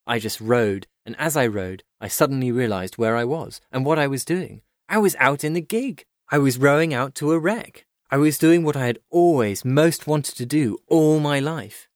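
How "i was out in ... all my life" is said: high, thrilled narration; mounting elation. A narrator's reading. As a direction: let the exhilaration lift the pitch. Narrator's thrill at rowing to wreck.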